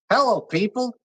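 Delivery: high Southern country voice; Joe Logan impression. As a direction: greet folks in a high country twang. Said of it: "That's how he sounds like".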